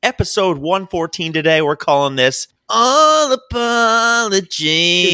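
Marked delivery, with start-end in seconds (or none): 2.7-5.1 slow title reveal. Acted. Episode title, slow drawn out.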